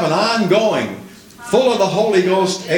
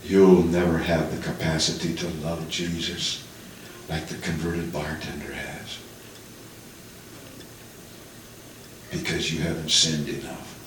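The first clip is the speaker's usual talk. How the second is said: low, hushed, much softer echo of the words; haunting echo; 11 semitones lower, 10 dB quieter than usual. Retold or imitated. Haunting words echoing in his brain, low and hushed. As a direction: let it haunt like a voice in the head.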